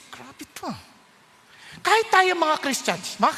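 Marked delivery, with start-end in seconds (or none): 0.1-0.8 soft, irritated aside; annoyed mutter. Acted. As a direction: mutter it under your breath.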